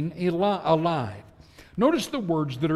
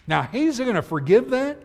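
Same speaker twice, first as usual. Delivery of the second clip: higher-pitched disbelieving question; incredulous question; 6 semitones higher than usual. Acted. Preacher voicing a sinner unsure of forgiveness, incredulous. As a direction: ask it as if it cannot be true.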